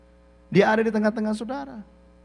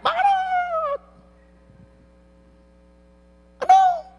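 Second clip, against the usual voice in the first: shouted call; slower than usual. Shown as a demonstration. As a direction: yell the name to someone far off.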